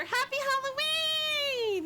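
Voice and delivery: high, slow drawn-out "Happy Halloween"; sing-song greeting. Real, not acted. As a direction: sing it out to a friend. Greeting friend, repeated.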